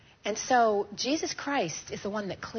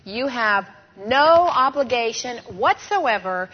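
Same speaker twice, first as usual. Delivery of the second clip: scripture recited aloud; 4 dB louder than usual. Shown as a demonstration. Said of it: "Ready? maybe you can say it with me now".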